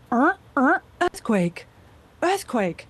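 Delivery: recorded alert voice, measured and urgent; announcer repeating an emergency alert; earthquake warning. Shown as a demonstration. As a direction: imitate an automated warning with alarm tones. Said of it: "heard me warn about earthquakes".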